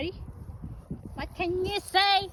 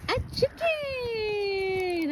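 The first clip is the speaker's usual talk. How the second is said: long, drawn-out delighted call; delighted cry; 4 semitones higher, much slower than usual. Real, not acted. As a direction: stretch it out very slowly, pitched up. Greeting the escaped chicken.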